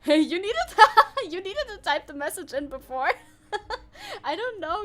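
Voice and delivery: laughing while talking; words breaking into laughter. Real, not acted. Laughing at the chat message.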